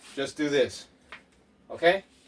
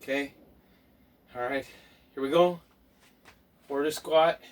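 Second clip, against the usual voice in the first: ordinary delivery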